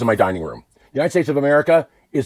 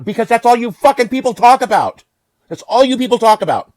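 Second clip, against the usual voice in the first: high, loud angry retort; furious shout; 12 semitones higher, 8 dB louder than usual. Real, not acted. Speaker answering people who always complain, furious shout. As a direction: shout it back at them, exasperated.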